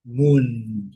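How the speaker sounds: moon said mun with a long U and a held N. Shown as a demonstration. Teacher stretching the sounds of moon. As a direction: moon with a drawn-out U and N. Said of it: "o N também, bem prolongado".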